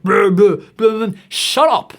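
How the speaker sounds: loud, exasperated blah blah then shut up; shouting down the hard-work speech; fed-up outburst. Real, not acted. Ranting host cuts off the hard-work line, loud and fed up. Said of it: "fed-up outburst".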